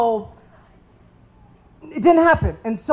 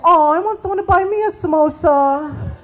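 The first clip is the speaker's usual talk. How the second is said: high, put-on whiny girl voice; mocking girls; 8 semitones higher than usual. Comic mocking the girls in his audience, high and whiny. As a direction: lampoon the girls who want a samosa. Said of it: "Some of you girls are like".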